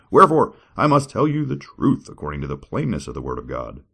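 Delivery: scripture reading. Acted. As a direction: declaim it like a preacher. Reads scripture.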